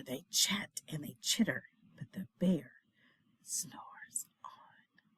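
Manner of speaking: soft unvoiced whisper, fading. A narrator's reading. Reader hushing near the sleeping bear, fading whisper. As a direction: breathe it out, barely audible. Hushed refrain.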